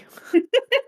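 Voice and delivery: short, high-pitched giggle; quick squeak of laughter. Real, not acted. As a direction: break into a brief high laugh. Laughs after her dying farewell.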